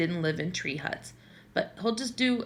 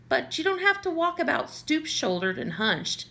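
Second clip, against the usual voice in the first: higher nagging mother voice; mother's scolding; 8 semitones higher than usual. A narrator's reading. Mother scolding Lorraine about her posture, higher voice. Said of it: "she has been nice enough to inform me".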